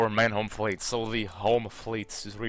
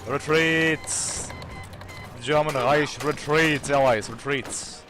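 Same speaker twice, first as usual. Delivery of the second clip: frantic retreat order; 3 semitones higher, 3 dB louder than usual. Pretend.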